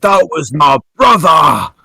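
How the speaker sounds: slow, high orc yell of grief and rage; furious shout. Retold or imitated. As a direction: roar it like an orc mourning his brother. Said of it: "he's like".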